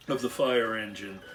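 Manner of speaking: ordinary delivery, nothing marked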